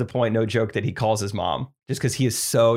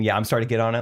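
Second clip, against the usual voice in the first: ordinary delivery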